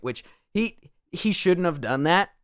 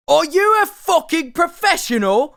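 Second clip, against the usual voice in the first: high, loud mock on-set rant in a broad accent; furious, hammed-up film star tirade, pitched way up; 13 semitones higher, 6 dB louder than usual. Actor blowing up at a crew member mid-scene, hammed-up outraged shout.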